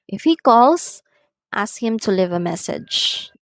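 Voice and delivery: rising condition, falling request; rise-fall intonation. Shown as a demonstration. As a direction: rise on "If he calls", fall on "ask him to leave a message". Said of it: "the tone rises in the first clause".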